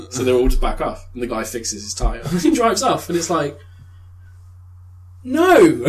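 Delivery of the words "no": high, loud wail of disappointment; loud protest. Real, not acted. Viewer protesting as the hero drives off, shouting. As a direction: cry out in mock despair.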